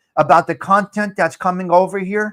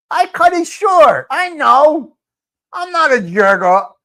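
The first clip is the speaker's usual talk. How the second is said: high defensive protest; 8 semitones higher, 5 dB louder than usual. Real, not acted. Defensive reply to teasing.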